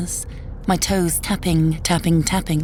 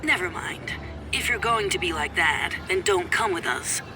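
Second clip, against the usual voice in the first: softer, filtered ex-husband on the phone; tinny phone voice; 6 dB quieter than usual. Acted. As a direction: argue down a thin phone line. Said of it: "a tinny voice coming from my phone".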